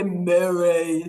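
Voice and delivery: slow, drawn-out superhero name call; lightly voiced superhero announcing a name. Shown as a demonstration. Coach shows children saying their name, as a superhero. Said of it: "Say your name like you're a superhero".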